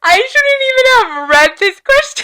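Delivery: high, half laughing, half crying; losing it over the question. Real, not acted. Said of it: "I am gonna have a good cry tonight".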